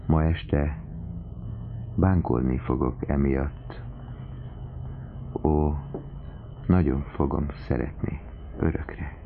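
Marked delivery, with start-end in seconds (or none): none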